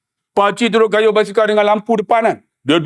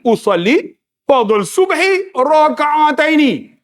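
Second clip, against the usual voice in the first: mock prayer recital; 6 semitones higher, slower than usual. Mimicking the prayer intention recital.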